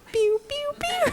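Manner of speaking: high squeaky pew-pew-pew; imitated pew noises. Comic miming buttons popping open, high imitated pews. Mimes unbuttoning with sound effects.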